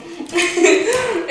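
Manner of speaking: high short giggle; bubbling high laugh; real laugh. Real, not acted. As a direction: giggle at your own fib. Laughs at her own fib.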